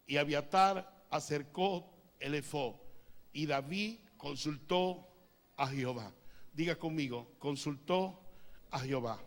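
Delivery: normal talking voice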